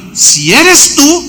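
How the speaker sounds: high, slow, unsure question; doubtful question. Acted. Peter unsure whether it is Jesus, doubtful question. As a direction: drag the doubt out of it.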